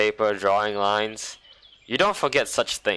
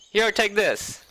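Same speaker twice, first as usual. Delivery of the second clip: camp leader voice; 9 semitones higher, slower than usual. Acted. Camp leader hands over sandwiches.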